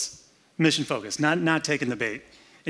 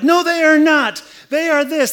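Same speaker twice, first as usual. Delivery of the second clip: indignant outburst; 13 semitones higher, 9 dB louder than usual. Pretend. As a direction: snap back in hurt outrage. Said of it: "He doesn't go like".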